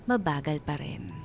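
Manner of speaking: normal talking voice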